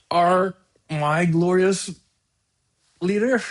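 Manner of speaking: slow, high, drawn-out mock awe; mock reverence. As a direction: say it like a fawning devotee, mockingly. Sarcastic reverence for the president.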